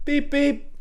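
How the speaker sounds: high squeaky beep beep; imitating the bus horn, high and squeaky; mock horn beep. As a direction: toot it like a cartoon horn. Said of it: "he just goes".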